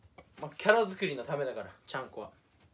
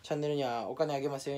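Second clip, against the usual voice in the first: normal talking voice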